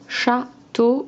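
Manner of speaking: "château" split as châ, pause, teau; syllable by syllable. Shown as a demonstration. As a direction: separate the two syllables of "château" for a pupil. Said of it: "Enlève la deuxième syllabe". (« château » dit en deux syllabes détachées)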